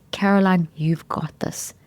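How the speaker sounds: calm yourself down gently; soothing whisper to yourself; calm kind voice. Shown as a demonstration. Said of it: "the way my voice has changed".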